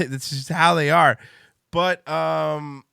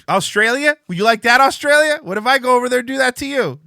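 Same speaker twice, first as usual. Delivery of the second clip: mock indignant shout; 10 semitones higher, 8 dB louder than usual. Real, not acted. Teasing Australian guest loudly.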